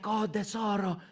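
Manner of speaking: lightly imitated Italian nonna endearment; Italian grandmother's accent, kept small. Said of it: "in Italian".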